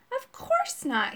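very high, slow, affronted denial; shocked. A narrator's reading. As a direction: draw it out in shocked protest. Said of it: "sounding shocked".

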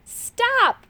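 high, urgent call; sharp high-pitched command. A narrator's reading. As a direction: call out to halt someone. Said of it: "called Moon Gobble".

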